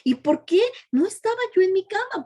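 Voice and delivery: put-on high, puzzled questions like a waking boy; confused young man. Son asks his weeping mother why, high and puzzled. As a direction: plead up high, not understanding the tears. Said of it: "se preguntaba".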